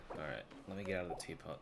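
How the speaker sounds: ordinary talk, nothing marked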